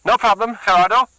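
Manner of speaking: high, loud, panicked; gasped in fear. Acted. Luis begging Gerardo near a blade, terrified. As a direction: plead for your life. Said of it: "he gasped".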